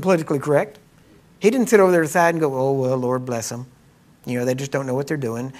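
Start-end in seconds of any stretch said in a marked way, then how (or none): 1.4-2.5 fast. Real, not acted. Preacher speeding up. 2.5-5.5 mock pious pity. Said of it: "He didn't say that".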